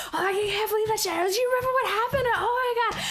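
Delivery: high-pitched giggly rush; excited gossip. Retold or imitated. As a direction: gush like old friends recalling a crazy night. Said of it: "talking all this shit".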